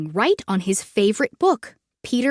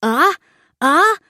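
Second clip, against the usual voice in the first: building sneeze; 5 semitones higher, 5 dB louder than usual. Acted. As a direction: sneeze wind-up rising higher. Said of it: "Floyd started".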